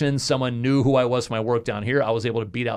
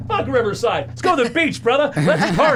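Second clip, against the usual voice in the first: much higher, laughing, hyped; gleeful, laughing celebration; mock party mode; 11 semitones higher, 3 dB louder than usual. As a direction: whoop it up with the cabbie. Joking to cab driver.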